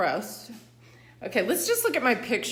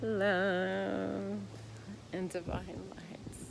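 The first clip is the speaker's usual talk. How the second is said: whispered blessing; 5 dB quieter, much slower than usual. Real, not acted. Repeated sign-off blessing.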